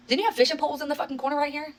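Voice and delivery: high, stunned question; incredulous question. Retold or imitated. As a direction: ask it like you can't believe it. Spotting missing fishing poles.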